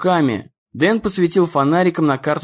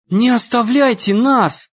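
desperate cry; 8 semitones higher than usual. A narrator's reading. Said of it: "закричала Эмми".

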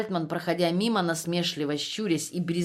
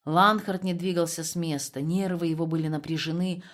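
ordinary delivery

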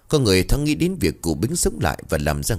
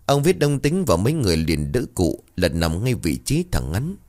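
usual speaking voice

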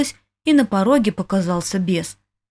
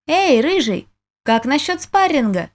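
raised, higher call across the gym; 6 semitones higher than usual. A narrator's reading. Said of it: "крикнула я".

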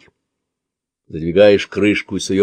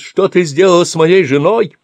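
coughing, anguished; 10 semitones higher, 5 dB louder than usual. Acted. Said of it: "закашлявшись".

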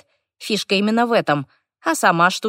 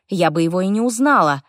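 ordinary delivery

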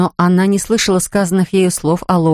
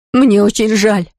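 desperate apology; 2 semitones higher, slower than usual. Acted. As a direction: cry it out in despair. Sister forced to confess.

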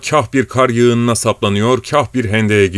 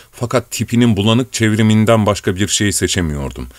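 plain reading voice

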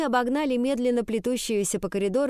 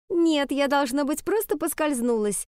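high, sweetly smiling denial; smiley false innocence; 6 semitones higher than usual. A narrator's reading. Bully covering up her kick, smiley innocence. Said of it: "со своей вечной улыбочкой".

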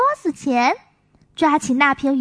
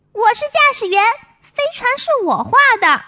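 put-on higher, eager little boy; child voice; 5 semitones higher, 4 dB louder, faster than usual. Songsong tells Maomao he drew the ship, higher child voice. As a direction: stake your claim like a proud kid.

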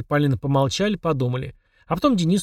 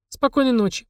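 high sweet goodnight; 11 semitones higher than usual. A narrator's reading. Children saying goodnight.

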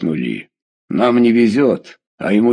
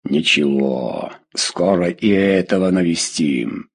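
deep bass voice; 6 semitones lower than usual. A narrator's reading. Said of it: "как пацук произнёс басом".

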